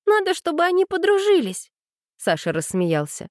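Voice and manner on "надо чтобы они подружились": put-on high, insistent girl's voice; stubborn childlike demand. A narrator's reading. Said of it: "упрямилась Аня".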